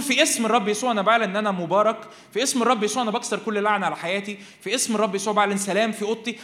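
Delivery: loud, bold prayer declaration; declaring prayer. Shown as a demonstration. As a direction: proclaim it with authority. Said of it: "وتقول".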